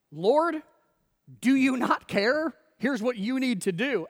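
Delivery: high, loud, demanding; bossy accusation. Acted. Martha instructing the one she called Lord, bossy and indignant. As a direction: take charge with pointed indignation. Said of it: "attempts to direct or to instruct".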